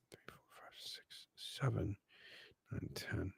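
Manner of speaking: counting under the breath; whispered counting. Real, not acted. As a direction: whisper the numbers to yourself.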